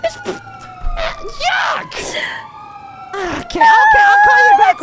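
high frantic yelling; losing it, screaming; unhinged shriek. Real, not acted. Freaking out over blowing job.

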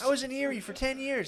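high, strained Trump voice; mock Trump. Friend mocking Trump on the suburbs, high strained. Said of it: "It's like".

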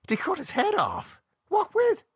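very high, incredulous questions; appalled and astonished, pitch shooting up; shocked disbelief. A narrator's reading. Learns his friend was beheaded.

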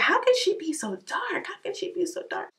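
imitating a child's high, hushed wonder; curious kids. Busan kids wondering at her skin, in a high hushed voice. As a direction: whisper it like an amazed little kid. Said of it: "they're probably like".

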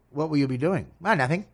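usual speaking voice

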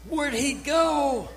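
high, slow panicked cry; freaked-out nurse. Retold or imitated. Nurse panicking at the empty bed, high and slow. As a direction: gasp it out like the bed's empty. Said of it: "she freaks out".

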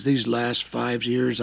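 usual speaking voice

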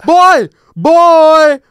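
very high, loud call; drawn-out mock angry shout; imitated holler. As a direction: yell across a street. Man yelling at him in street.

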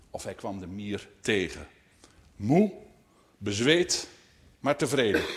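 measured read-aloud narration; story narration. A narrator's reading. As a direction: tell it unhurriedly to children. Reads a story to children.

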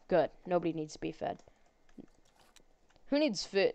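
ordinary talk, nothing marked

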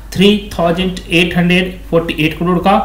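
normal talking voice

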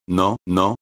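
ordinary delivery, nothing marked

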